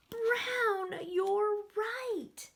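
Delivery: high, loud delighted gasp and answer; thrilled praise. Acted. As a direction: cheer the kids on. Excited praise to kids.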